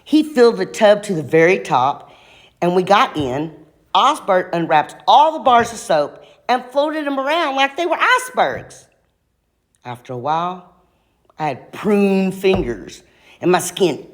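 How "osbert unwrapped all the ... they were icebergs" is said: plain reading voice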